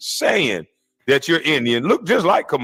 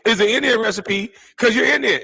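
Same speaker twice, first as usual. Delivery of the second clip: higher-pitched mimicry of the cooking lady; mock her phony talk in a higher voice; mock cooking-show host; 6 semitones higher than usual. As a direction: imitate a fake TV cook. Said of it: "Who even talks like that?".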